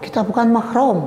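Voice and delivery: very high, flustered protest; panicky mock plea. Cornered young man protesting to princess, comically panicked. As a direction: squeak out the objection.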